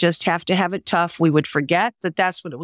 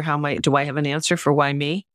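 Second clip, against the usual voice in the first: ordinary delivery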